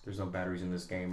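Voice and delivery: low, very soft mock sulk; imitated sulky child. Daughter quitting the game, imitated quiet sulk. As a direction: drop it to a pouting murmur. Sulking daughter quoted by dad.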